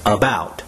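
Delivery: "About" with the weight on "bout"; light "A", accented "bout" in "About"; second-syllable stress. Shown as a demonstration. Teacher modelling word stress, "About" stressed on its second syllable. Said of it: "second syllable is to be accented".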